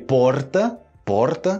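"porta" with a tongue-tip tapped R; Spanish-like R. Shown as a demonstration. Teacher shows regional Brazilian options, Spanish-like R demonstration. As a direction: tap the R in "porta". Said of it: "como é espanhol praticamente".

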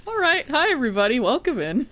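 high-pitched hello, laughter creeping in; retold cheery greeting. Streamer retelling the welcome to viewers, high mock-cheery greeting. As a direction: imitate your own high, laughing welcome. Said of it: "I was like".

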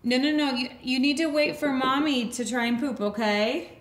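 normal talking voice

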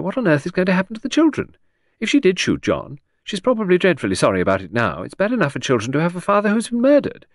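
high, breezy, rambling society lady; vague aristocratic lady. A narrator's reading. Lady rambling cheerfully to the inspector, high and airy. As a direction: chatter on brightly as a scatterbrained aristocrat. Voices Lady Angkatell at length.

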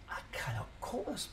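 hushed, breathy disbelief under the breath; panicked whisper. Retold or imitated. Recalling the overwhelming opening rush, a mock panicked whisper. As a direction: whisper it like the rush is too much.

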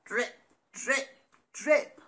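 light drip sounds; dripping tap. Acted. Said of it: "went the leaky tap".

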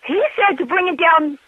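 ordinary delivery

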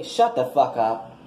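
ordinary talk

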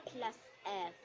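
boring teacher. Acted. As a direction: drone at the class like a bored teacher. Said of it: "The boring teacher".

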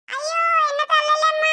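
high frantic begging; frightened pleading. Acted. Child begs mother not to hit him, very high and frantic. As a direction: plead to escape a beating.

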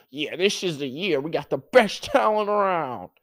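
mock boastful team-owner voice, climbing high; Jerry Jones. Owner trying to convince people, mock boasting. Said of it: "Jerry Jones do all that talking".

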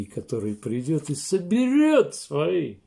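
ordinary delivery

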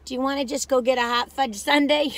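ordinary talk, nothing marked

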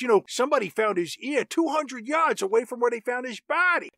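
put-on police investigator, pitched a little up; cop voice. Officer questioning woman about two deaths, caricature cop voice. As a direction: play a caricature cop going through the case.

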